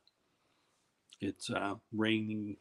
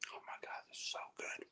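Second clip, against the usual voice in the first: whispering; 12 dB quieter than usual. Real, not acted. Savoring a hot marshmallow.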